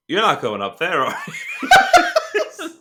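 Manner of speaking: retold line collapsing into a high laugh; breaking into laughter. Real, not acted. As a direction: crack up on the last words of the quote. Cracks up retelling the punchline.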